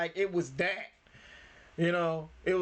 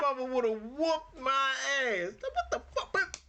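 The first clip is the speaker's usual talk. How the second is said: laughing; 7 semitones higher, slower than usual. Real, not acted. Laughing at the cake scene.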